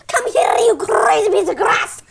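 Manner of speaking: put-on high Scottish accent, rolled R's; Max's Scottish accent. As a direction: call out as a Scottish terrier, trilling the R's. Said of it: "I'm just telling you that Max says".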